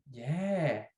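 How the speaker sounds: usual speaking voice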